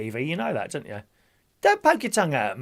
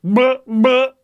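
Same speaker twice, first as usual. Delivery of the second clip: silly noise; 8 semitones higher, 10 dB louder than usual. Acted. Silly noise after ducks.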